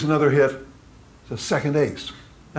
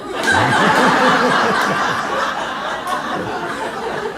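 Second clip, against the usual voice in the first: loud rising burst of laughter; real laughter; 11 semitones higher, 6 dB louder than usual. Real, not acted. Laughing out loud at the joke he just told the audience. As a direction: laugh hard at your own punchline.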